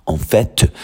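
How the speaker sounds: "fait" said as "fet", T sounded; pronounced final T. Shown as a demonstration. Teacher showing that this expression keeps its T, sounded final T. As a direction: let the T at the end of "fait" be heard. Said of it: "you say the T".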